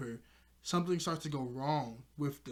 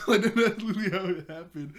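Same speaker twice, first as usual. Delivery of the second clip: higher, louder, real laugh; 4 semitones higher, 8 dB louder than usual. Real, not acted. Host laughing at the awkward scene, real laughter. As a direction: laugh while insisting it's true.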